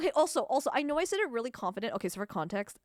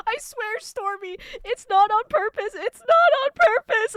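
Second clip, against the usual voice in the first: high, loud protest of innocence; pleading protest; 10 semitones higher, 10 dB louder than usual. Real, not acted. Streamer swearing to Stormy it's accidental, loud pleading. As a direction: plead innocence, building louder and higher.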